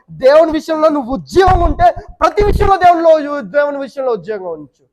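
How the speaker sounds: high, loud preacher's cry; forceful preaching. Acted. Preacher urging the congregation, loud and high. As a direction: drive the point home with force.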